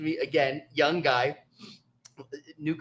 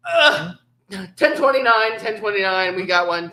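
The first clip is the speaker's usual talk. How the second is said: radio call; 4 semitones higher, 7 dB louder than usual. Retold or imitated. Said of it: "And I was like".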